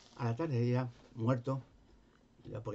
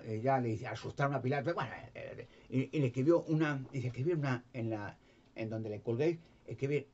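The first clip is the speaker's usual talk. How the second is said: ordinary talk, nothing marked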